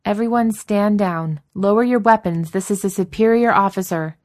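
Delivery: loud command. A narrator's reading. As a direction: order the troops to stand down. Commander ordering armed troops.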